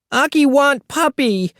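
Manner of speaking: put-on high whiny toddler plea; pleading toddler. A narrator's reading. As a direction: beg like a clingy little kid. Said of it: "he said".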